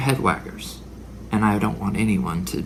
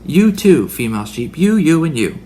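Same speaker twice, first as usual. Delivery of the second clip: louder and higher, pointing people out; calling out; 3 semitones higher, 6 dB louder than usual. Retold or imitated. Preacher mock-calling out the rams in the congregation. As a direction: call them out across the flock. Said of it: "we say to that ram".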